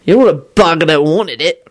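tipsy, casual cheer; mildly jolly, loose-tongued; cheerful. A narrator's reading. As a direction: tell him with slurred good cheer. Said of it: "Victor told him cheerfully".

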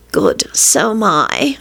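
slow, drawn-out moan of agreement. A narrator's reading. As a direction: moan it slowly, savouring each word. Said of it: "she moaned again".

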